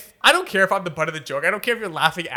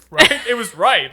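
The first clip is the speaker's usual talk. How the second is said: usual speaking voice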